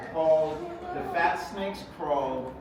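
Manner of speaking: plain reading voice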